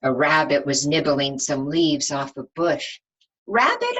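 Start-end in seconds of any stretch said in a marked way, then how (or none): 3.5-4.0 high, loud rising call; sing-song call. Acted. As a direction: call the rabbit's name upward. Calling out to the rabbit.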